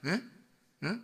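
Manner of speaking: normal talking voice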